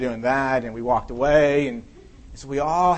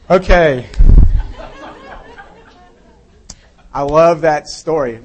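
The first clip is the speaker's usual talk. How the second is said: much louder, delighted; 11 dB louder than usual. Real, not acted. Pastor reacting to his own joke, loud and delighted. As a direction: say it with a big grin.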